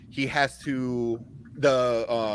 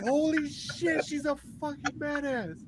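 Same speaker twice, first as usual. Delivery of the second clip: stunned amazement; 13 semitones higher, 7 dB quieter than usual. Retold or imitated. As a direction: relive the jaw-drop moment in the cinema. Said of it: "I'm like".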